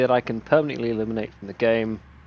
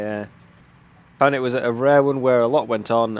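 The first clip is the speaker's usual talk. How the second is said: usual speaking voice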